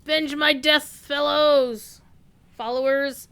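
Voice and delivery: loud high dying cry, hammed-up; overacted death scene. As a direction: ham up a dying villain's last call. Voicing dying enemy.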